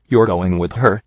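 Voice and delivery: usual speaking voice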